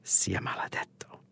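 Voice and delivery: hushed, unvoiced stage whisper. A narrator's reading. Narrator as the dispersing crowd, whispering the curse. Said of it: "the crowd dispersing as they whisper".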